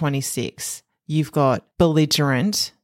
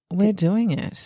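flat, bored monotone; 3 semitones higher, slower than usual. Retold or imitated. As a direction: say it dead flat, no energy. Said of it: "In, like, the most monotone".

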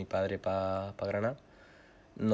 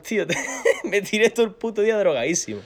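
loud, high, laughing through the words; cracking up while telling it; real laughter bursting out; 10 semitones higher, 9 dB louder than usual. Real, not acted.

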